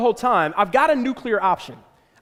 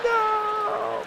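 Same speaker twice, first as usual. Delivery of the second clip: high, softer long-drawn NO; voice of reason crying no; 13 semitones higher, 6 dB quieter than usual. Acted. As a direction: wail a faraway warning in slow motion. Distant voice of reason.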